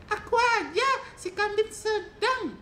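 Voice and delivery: tiny high squeaky goat; loud, very high little goat voice; medium goat. Acted. Middle goat answers its big brother, very high, loud goat voice. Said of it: "kata si kambing sedang kepada kambing besar".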